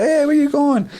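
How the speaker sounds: squeaky put-on woman calling after him; mock woman's voice. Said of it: "She's like".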